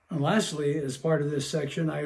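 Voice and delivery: ordinary talk, nothing marked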